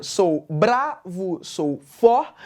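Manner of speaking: "sou bravo, sou for" slow, punching "bra" and "for"; "sou bravo, sou for" with strong beats on "bra" and "for"; stressed-syllable scansion. Shown as a demonstration. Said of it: "enuncio com mais força são as tônicas".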